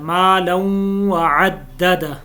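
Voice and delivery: मालन ending held as a long nasal ghunna, very slow. Shown as a demonstration. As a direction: hum the नन of मालन through the nose. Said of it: "मालन के ऊपर गुन्ना है".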